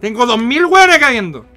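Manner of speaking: loud high indignant shout. Real, not acted. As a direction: shout back, offended. Indignant retort to game character.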